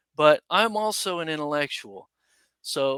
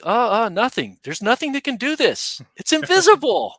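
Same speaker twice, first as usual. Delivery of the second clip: climbing higher and louder with each line; rising excitement; 8 semitones higher, 6 dB louder than usual. Retold or imitated. Soldier insists light can't be explained, building excited rise. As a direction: build from stammer to awed high pitch. Retold awe at strange light.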